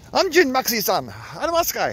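playful mock-Japanese address, high and loud. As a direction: hail the dog in jokey mock Japanese. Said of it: "It's, uh, Japanese".

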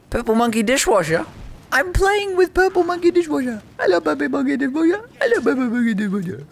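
very high squeaky silly voice; silly squeal. Pretend. Gamer greeting a player with an absurd name, silly squeaky voice. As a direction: squeak it giddily, up in falsetto.

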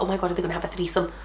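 fast, soft muttered aside; rushed aside. Real, not acted. Host reacts to the fic with a quick soft aside. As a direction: rattle it off under your breath.

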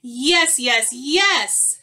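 overexcited storybook skunk; eager yes. Acted. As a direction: beg with mounting glee. Skunk eagerly wants another hug.

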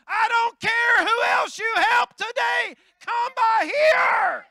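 high, loud crying-out plea, slow at the end; desperate shout. Acted. Bartimaeus crying out to Jesus, a high desperate shout. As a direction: cry out like a blind beggar who won't be ignored. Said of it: "Bartimaeus says".

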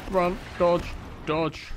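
rushed in the heat of the chase; urgent excited calls. Real, not acted. Fleeing enemies.

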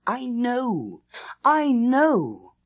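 high, rising to a loud triumphant insistence; growing exultation. A narrator's reading. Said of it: "My exultation grew".

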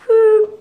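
loud high whistle blast; short piercing "phew"; imitated whistle. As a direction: mimic a recess whistle. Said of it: "when the whistle blew".

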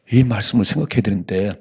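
normal talking voice

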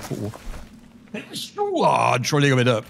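real sneeze building up; sneeze, then apologise; sneezing. Real, not acted. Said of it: "Oh, ich muss niesen".

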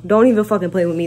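usual speaking voice

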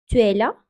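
"Tu es là" with a questioning rise on "là"; question tune. Shown as a demonstration. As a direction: make "Tu es là" a clear question. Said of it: "Soru cümlesinde ise". ("Tu es là" soru sorma tonuyla)

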